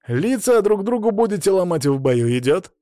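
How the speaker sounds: firm, fairly loud intervention; barked order. A narrator's reading. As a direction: break up the fighters with authority. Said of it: "рявкнул Паша".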